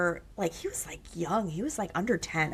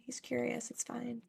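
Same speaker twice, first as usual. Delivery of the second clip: soft, higher-pitched reassurance; hushed, high, placating tone; mock soothing reply; 6 semitones higher, 10 dB quieter than usual. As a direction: gently talk down a panicked parent. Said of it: "I'm like".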